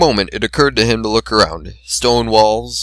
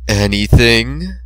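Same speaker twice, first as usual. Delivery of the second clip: slow drawn-out questioning voice; slow teasing goddess voice; mysterious voice; much slower than usual. Acted. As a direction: tempt him with one slow word. Mysterious voice answers.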